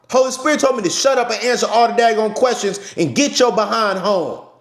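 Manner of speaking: loud, high fired-up sermon delivery; loud passionate street preacher; passionate preaching. Real, not acted. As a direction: preach it at full force to your followers. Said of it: "I ain't yelling. I'm just passionate".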